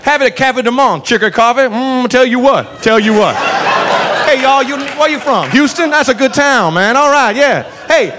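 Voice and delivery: chatty, high-pitched Southern lawman; imitating a genial Louisiana cop; Southern cop tour-guide. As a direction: sell the local food with folksy relish. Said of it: "proceeds to give us tourist tips".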